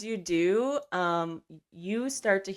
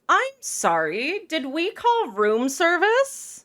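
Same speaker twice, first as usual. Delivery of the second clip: haughty elf noble, high and slow; condescending; 10 semitones higher, slower than usual. Acted. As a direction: look down on a bug of a man. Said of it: "stares down at you like you are nothing more than a bug".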